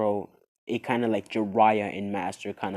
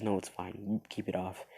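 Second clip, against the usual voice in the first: soft flirty; 3 semitones lower, 9 dB quieter than usual. Acted. Haku flirting softly.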